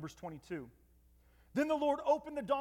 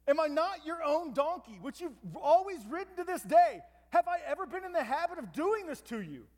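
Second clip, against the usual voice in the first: higher, louder, pleading and incredulous; reasoning donkey; 6 semitones higher, 4 dB louder than usual. Retold or imitated. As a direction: plead with your owner, amazed. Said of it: "the donkey is reasoning with this man".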